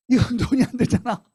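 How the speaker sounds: laughing through the words; amused laughing aside. Real, not acted. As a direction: chuckle as you say it.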